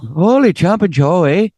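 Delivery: put-on playful Canadian accent on Holy jumping Joe; hammed-up Canadian. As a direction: ham up the Canadian phrase. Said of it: "There's their Canadian for you".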